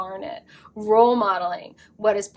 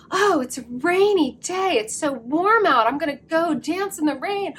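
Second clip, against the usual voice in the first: higher, sing-song gushing voice; mimicking listeners; 6 semitones higher than usual. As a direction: play a carefree dreamy person, lightly. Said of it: "mimicking your voice".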